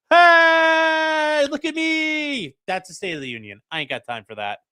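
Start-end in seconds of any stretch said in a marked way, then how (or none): 0.1-2.6 high, shouted "Hey" then a slow drawn-out ask; balcony heckler. Retold or imitated. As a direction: call down from the balcony for attention. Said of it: "people in the balcony going".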